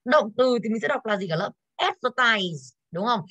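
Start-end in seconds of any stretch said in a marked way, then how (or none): 1.8-2.8 Advertise with a slow, weighty AD; stress on first syllable. Shown as a demonstration. Teacher models the verb for the class, first-syllable stress on "Advertise". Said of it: "trọng âm nó sẽ rơi vào tiết đầu".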